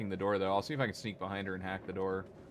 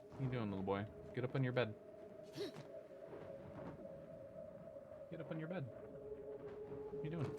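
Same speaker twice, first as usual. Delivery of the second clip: pet talk; 2 semitones higher, 7 dB quieter, faster than usual. Real, not acted. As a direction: gently coax the animal onto its bed. Talking softly to his pet.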